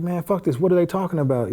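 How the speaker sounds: retold angry outburst. Inmate who flipped the captain's desk, retold exasperated outburst. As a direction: replay a heated refusal to believe it.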